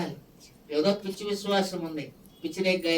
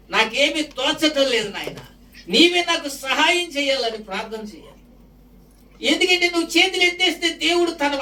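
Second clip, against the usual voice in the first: high, loud, impassioned sermon; preaching at full force; 7 semitones higher, 6 dB louder than usual. Acted. Pastor exhorting the church to hand problems to God, preaching at full force. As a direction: pour it out, pleading and driving.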